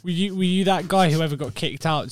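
ordinary talk

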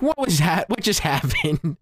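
giddy confusion with a laugh; laughing bewilderment. Retold or imitated. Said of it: "And he's like".

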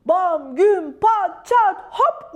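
loud, punched-out sound words; big storybook bangs; booming crash noises. A narrator's reading. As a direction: hit each crash word hard, separately. Crash noises of the landing ambulance.

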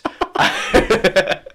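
breathy high cackle at his own mischief; gleeful laugh. Real, not acted. Laughing after admitting he deleted his friend's project, gleeful cackle. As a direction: laugh out at the prank.

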